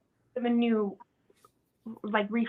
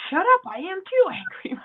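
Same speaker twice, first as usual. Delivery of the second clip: higher, indignant comeback; very upset retort; 5 semitones higher than usual. Acted. Upset worker to doubting friend, indignant retort. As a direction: snap back at a dismissive friend. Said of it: "you'd be very upset".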